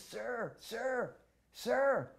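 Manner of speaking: rising high calls for attention; calling out. Retold or imitated. A boy calls out for Prabhupada's attention, high. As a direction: call out to catch him. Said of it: "trying to get Prabhupada's attention".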